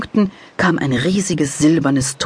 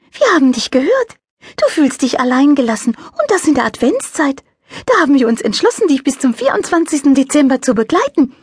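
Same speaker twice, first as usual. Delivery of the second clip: high, light angel voice; little angel voice; 12 semitones higher, 4 dB louder than usual. A narrator's reading. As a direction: play a cheerful little angel. Angel character voice.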